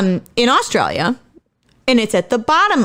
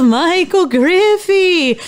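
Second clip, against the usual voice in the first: slow, high, eerie spooky ghost voice; 7 semitones higher, much slower than usual. Pretend. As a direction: draw out the name like a haunting spirit. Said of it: "that's my ghost voice".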